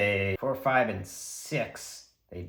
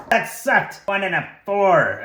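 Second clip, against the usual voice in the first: high, loud burst of dismay; loud disappointment; 8 semitones higher, 8 dB louder than usual. Real, not acted. Player seeing one and a four, loudly dismayed. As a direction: exclaim over a lousy roll. Reacting to a poor damage roll.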